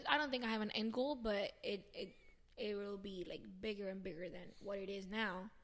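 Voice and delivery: ordinary talk, nothing marked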